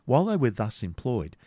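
ordinary delivery, nothing marked

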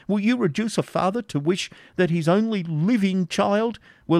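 put-on high, trembling old father begging for mercy. Isaac begs the Norman baron to spare his daughter, high pleading voice. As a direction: beg the baron to spare your child.

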